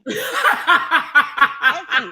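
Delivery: laughing. Real, not acted. Laughing after punchline.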